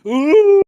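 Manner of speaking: very high, loud drawn-out "ooh"; hammed-up back-from-the-dead wail; Undertaker-style rising "ooh". [name] suddenly rising like the Undertaker, mock wail. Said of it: "then, like the Undertaker".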